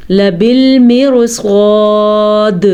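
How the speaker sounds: لَبِالْمِرْصَادِ with a thick, heavy ra, not thin; slow لَبِالْمِرْصَادِ, ra full in the mouth; لَبِالْمِرْصَادِ read slowly with heavy ra. Shown as a demonstration. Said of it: "یہ را باریک نہیں ہے".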